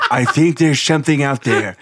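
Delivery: loud presidential impression, part Reagan; hammed-up president, laughing through it; Donald Trump. President just watched Space Jam, loud mock-statesman. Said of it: "a Trump Reagan accent".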